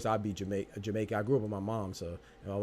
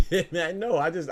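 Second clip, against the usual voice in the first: talking through real laughter, higher and louder; words broken by laughs; laughing; 6 semitones higher, 5 dB louder, slower than usual. Real, not acted. Cracking up at a chatter's message, laughing.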